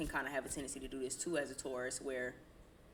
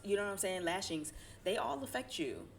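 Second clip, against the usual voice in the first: normal talking voice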